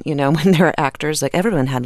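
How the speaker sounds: normal talking voice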